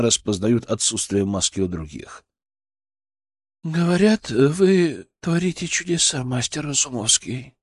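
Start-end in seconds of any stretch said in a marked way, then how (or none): 3.6-7.5 chesty, slightly hoarse and tired; husky voice with a touch of irony; low husky voice. A narrator's reading. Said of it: "голос у неё оказался ниже... Грудной, чуть хрипловатый".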